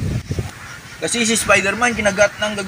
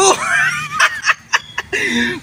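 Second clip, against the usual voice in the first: villain laugh. Acted. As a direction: throw back your head and cackle. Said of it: "parang kontrabida yung tawa".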